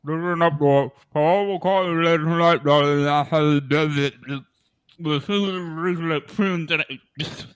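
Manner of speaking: high, loud, garbled through a mouthful of water. Shown as a demonstration. Host performs the tagline with water in his mouth. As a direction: talk with a sip of water held in the mouth. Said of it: "all of that is done, after I've taken a sip of water and left it in my mouth".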